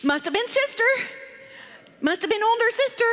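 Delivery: high-pitched little blame-shifting voice; afraid child. Retold or imitated. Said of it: "She was too afraid".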